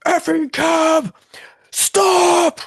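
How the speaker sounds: high, slow furious scream at the cab; furious yell. Retold or imitated. Drunk friend screaming at a taxi, furious high yell. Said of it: "Steve goes".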